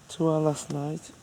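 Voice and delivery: high, singsong clinking-glasses toast; playful toast. Real, not acted. Partner toasting their last night together, playful toast. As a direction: pitch it up high for a cutesy cheers.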